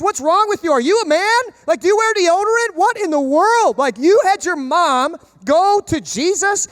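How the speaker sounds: high, loud, mocking outrage; indignant disciples. Preacher voicing the disciples ranting at James and John, high and loud. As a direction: roast them like a furious gym bro. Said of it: "It's like".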